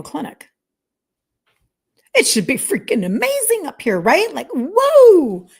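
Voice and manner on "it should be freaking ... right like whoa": sarcastic disbelief. Real, not acted. Sarcastic about Mayo Clinic land.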